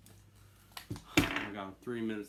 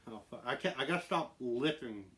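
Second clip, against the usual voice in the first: ordinary delivery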